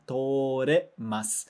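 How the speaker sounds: Toremasu said slowly, the re kept clear; slow model word. Shown as a demonstration. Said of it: "o torimasu vai ficar toremasu".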